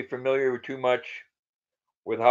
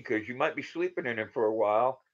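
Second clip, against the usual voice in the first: ordinary talk, nothing marked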